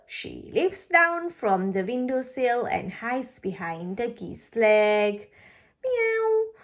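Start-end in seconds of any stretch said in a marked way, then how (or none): 5.8-6.5 high little mew; cat meow. Acted. Enid hiding behind Ducky's leg, a high mew. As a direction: mew like a scared kitten.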